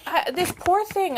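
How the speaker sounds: ordinary talk, nothing marked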